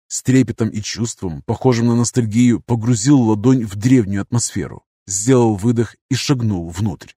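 ordinary delivery, nothing marked

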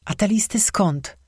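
ordinary delivery, nothing marked

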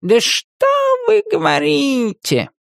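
hammed-up astonishment, slow; cat mocking surprise; fake surprise. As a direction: pretend to be amazed. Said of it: "ненатурально удивился кот".

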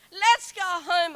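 slow, high, loud mock whine; whinging. Wife begging her husband to go home, slow loud whine. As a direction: drag out the plea to leave. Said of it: "Well, I was like that".

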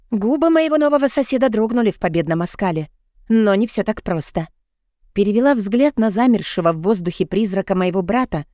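ordinary delivery

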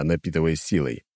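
ordinary delivery

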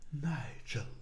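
softly breathed out, hoarse; hoarse exhale. Acted. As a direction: breathe the name out in despair. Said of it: "Хрипло выдохнул Роберт".

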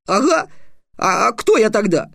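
put-on high indignant boy flaring up; a boy bristling at the insult, voice up high.

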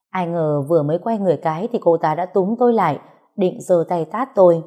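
plain reading voice

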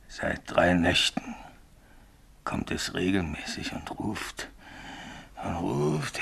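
barely above a breath, haunted; hushed dread. Acted. Signalman quietly confesses to Bill, drained and hushed.

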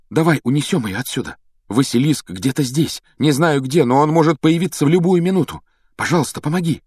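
high, hurried, anxious pleading; urgent plea. A narrator's reading. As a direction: beg for help before danger strikes. Harry pleads, monster is near. (встревоженная, торопливая мольба)

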